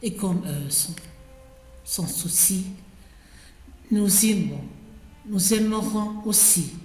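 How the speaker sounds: measured poem reading with pauses between phrases; poetry recitation. Acted. Reader recites Ronsard's ode to Cassandre, measured poetic recitation. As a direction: recite it line by line, giving each phrase room.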